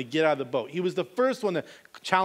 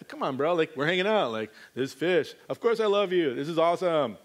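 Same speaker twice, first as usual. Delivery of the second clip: laid-back buddy voice of Peter; lightly voiced laid-back Peter; casual Peter; 2 semitones lower than usual. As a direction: play Peter as a chill bro. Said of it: "Peter's like".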